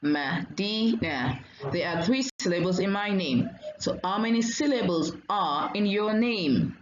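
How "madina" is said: Madina broken slowly into Ma, di, na; syllable by syllable. Shown as a demonstration. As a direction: draw out Madina in three separate beats. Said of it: "say your name and count the syllables".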